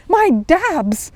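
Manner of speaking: alarmed. Acted. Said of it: "repeated Paddington in alarm".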